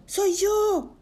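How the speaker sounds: very high little creature voice; tiny thin voice. Acted. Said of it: "le dijo una voz finita". (vocecita finita, muy aguda)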